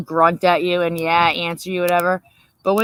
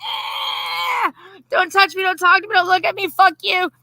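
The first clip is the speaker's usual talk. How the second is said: hammed-up cranky husband; 11 semitones higher than usual. Wife mocking husband's morning tantrum, high and wailing. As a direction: shriek it like a whiny grouch. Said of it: "he's like".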